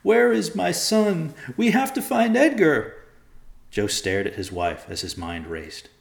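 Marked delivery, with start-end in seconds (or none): 0.0-3.0 high, loud, desperate plea; frantic wailing. A narrator's reading. Mother begs to find her son, wailing. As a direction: cry out in panic for a lost child. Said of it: "she wailed".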